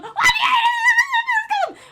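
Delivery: Billy horrified, squealing through laughter; shrieking disbelief. Acted. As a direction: squeal it in horror. Shocked at the kill plan.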